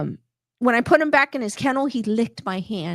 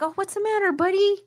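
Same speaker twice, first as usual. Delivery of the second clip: soothing pet talk; 9 semitones higher, faster than usual. Retold or imitated. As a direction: coo at the sad puppy. Soothing a sulking shelter dog.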